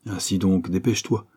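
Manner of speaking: ordinary delivery, nothing marked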